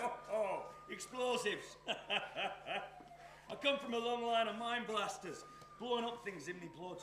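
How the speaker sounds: loud, high, laughing delight; gleeful gloating. Acted. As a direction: crow over the explosions with a laugh. Arsonist gloats over the blasts.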